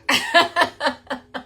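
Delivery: laughing. Real, not acted. Story of claustrophobic sister.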